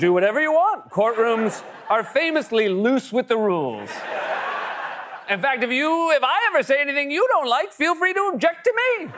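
high, mocking sarcastic judge. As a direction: drip with sarcasm, laughing. Mock judge replying sarcastically.

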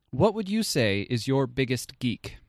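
ordinary talk

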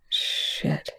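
hushed, awed curse; breathy impressed curse. A narrator's reading. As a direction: half-whisper it, struck by it. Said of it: "Young said, impressed".

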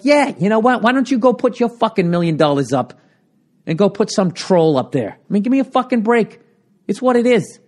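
high-pitched comic indignation; ranting, fed-up comeback; exasperated retort. Acted. Acted retort to the complainers.

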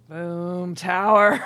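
loud, rising exclamation; excited reveal. Real, not acted. Tarot reader flipping the Tower card, excited. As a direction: announce the card with a bang.

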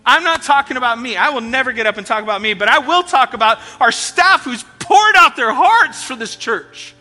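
loud, high full-force preaching; rally the church to stand up; passionate preaching. Acted.

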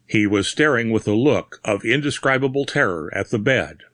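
ordinary delivery

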